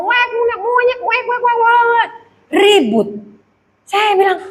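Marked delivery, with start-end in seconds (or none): 0.0-2.1 high, rapid squawking jumble; mock animal-noise cursing. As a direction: imitate a quarrel as zoo noises. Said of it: "semua kebun binatang keluar". (tiruan ribut suara binatang, melengking)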